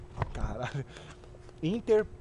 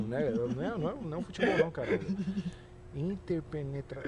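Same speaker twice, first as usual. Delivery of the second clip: normal talking voice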